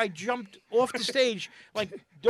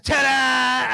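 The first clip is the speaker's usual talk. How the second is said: triumphant ta-da; 6 dB louder than usual. Retold or imitated. Comic ends act triumphantly.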